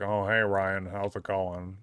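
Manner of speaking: low-key Squidward-like greeting; Squidward-like coworker. Retold or imitated. Older coworker greeting Ryan, light Squidward-like impression. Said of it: "he would just kinda talk like this".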